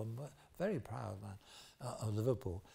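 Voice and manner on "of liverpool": ordinary talk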